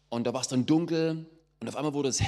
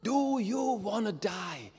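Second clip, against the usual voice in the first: high, slow, drawn-out frontman call; screamed again; 7 semitones higher, 3 dB quieter, much slower than usual. Retold or imitated. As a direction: call it out to the crowd like a metal singer. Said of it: "hat reingeschrien". (hoher, gedehnter Schrei des Sängers)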